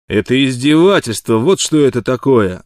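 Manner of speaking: loud enraged protest; furious. A narrator's reading. Wild Water balks at the price, furious. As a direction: blast it out in outrage. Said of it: "объявил взбешённый Уайлд Уотер".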